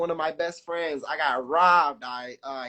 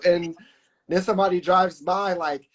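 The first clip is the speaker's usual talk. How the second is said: ordinary talk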